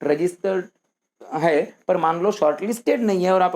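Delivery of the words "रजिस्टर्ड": normal talking voice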